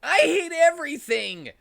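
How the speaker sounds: screamed high and loud into a pillow; furious shout. Acted. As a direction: yell it out in pure rage. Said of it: "shouted into his pillow".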